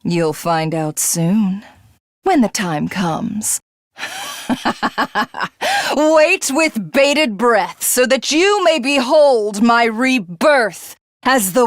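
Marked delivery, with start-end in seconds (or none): 4.0-5.9 high, haughty villainess laugh; gloating laugh. Acted. Villainess gloating at the invaders, high mocking laugh. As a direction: cackle up high in triumph.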